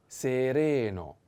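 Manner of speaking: "sereno" drawn out syllable by syllable; slow model pronunciation. Shown as a demonstration. Teacher models the new word for learners, slow "sereno". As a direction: stretch each vowel of "sereno" slowly.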